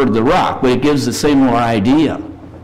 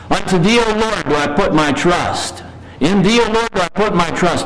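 scripture reading; 6 semitones higher than usual. Acted. Preacher reading the psalm to his congregation, reverent and higher. Reads Psalm thirty-one.

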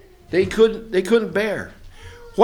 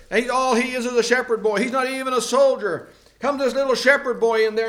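ordinary delivery

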